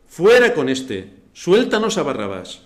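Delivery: loud, slow hostile crowd cry; crowd shouting. Retold or imitated. Preacher voicing the mob demanding Barabbas, loud and slow. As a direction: yell it as the angry mob. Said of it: "dio voces a una diciendo".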